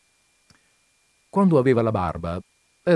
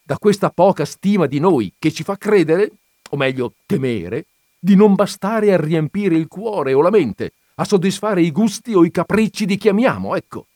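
louder, higher heated outburst; an angry lover venting at her; 8 semitones higher, 6 dB louder than usual. A narrator's reading. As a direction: let the resentment pour out. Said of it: "rispose lui con rabbia".

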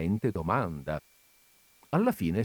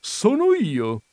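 high, loud, drawn-out proud declaration; 11 semitones higher, 6 dB louder, slower than usual. A narrator's reading. Countess admitting she writes as Nirvana, proud. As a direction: own up to it with haughty pride. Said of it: "disse la contessa con fierezza".

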